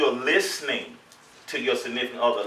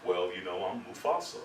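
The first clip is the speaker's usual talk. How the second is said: low, soft, unruffled reply; deep, hushed comic composure; Mufasa; 9 semitones lower, 12 dB quieter than usual. Acted. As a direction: answer her as a cool lion king. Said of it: "you gotta be like Mufasa".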